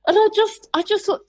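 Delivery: ordinary delivery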